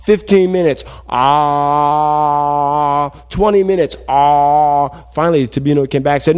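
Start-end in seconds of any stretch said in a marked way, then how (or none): 1.1-3.1 long, steady held "ah"; open-mouthed waiting. Acted. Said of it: "he was waiting like this". 4.1-4.9 long, steady held "ah"; open-mouthed waiting. Acted. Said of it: "he was waiting like this".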